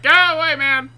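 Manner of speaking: high, loud shooing voice; annoyed car. Acted. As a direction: yell like a driver being bothered. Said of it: "that car's just like".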